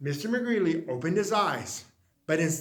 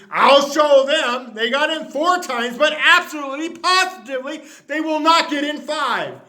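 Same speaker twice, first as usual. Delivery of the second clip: higher, louder determined threat; shouting his promise at the bunnies; furious vow; 5 semitones higher, 5 dB louder than usual. Acted. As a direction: vow it, hammering each word.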